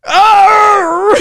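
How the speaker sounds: long, loud, high roar of rage; angry roar. Retold or imitated. Coach enraged by interviewer's answer, roaring. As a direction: imitate a coach exploding with a wordless yell. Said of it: "he got so angry".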